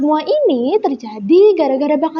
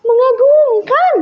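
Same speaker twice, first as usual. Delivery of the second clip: high, loud, stretched-out praise; admiring exclamation; 10 semitones higher, 5 dB louder, slower than usual. A narrator's reading. Bu Fora surprises Kagau with applause, exclaiming in admiration. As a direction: call it out slowly with big admiration.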